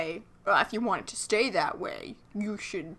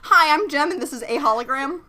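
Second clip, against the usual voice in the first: loud, higher cheerful intro; mock Jem; 6 semitones higher, 6 dB louder than usual. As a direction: chirp the greeting like a cartoon star.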